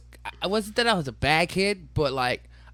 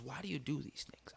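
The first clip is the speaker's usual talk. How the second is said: very soft, whispered question; imitated hushed mother; 14 dB quieter than usual. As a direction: whisper it as a concerned mom. Mother's grave talk with child.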